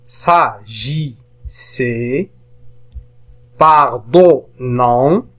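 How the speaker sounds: "S'agissait", "pardonnant" very slowly split into syllables; slow teacher reading, gaps inside "pardonnant". Shown as a demonstration. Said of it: "Alors je relis les mots".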